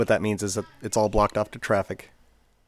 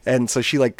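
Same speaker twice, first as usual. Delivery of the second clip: ordinary talk, nothing marked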